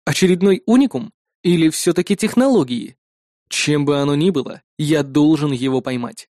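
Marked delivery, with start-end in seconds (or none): none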